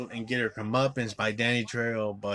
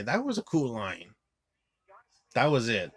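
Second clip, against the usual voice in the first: ordinary talk, nothing marked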